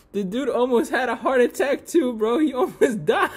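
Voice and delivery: high, laughter cracking the words; giggling through the story; laughing. Real, not acted. Telling the audience about the heart attack, laughing high. Remembering the fighter nearly dying.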